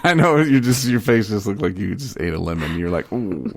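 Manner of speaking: high, loud laughing talk. Real, not acted. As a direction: talk through a real burst of laughter. Laughing at friend's face.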